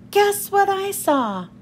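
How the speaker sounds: high, slow teasing call; mild excited yell. A narrator's reading. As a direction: call it out high, bursting with news. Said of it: "Peter yelled".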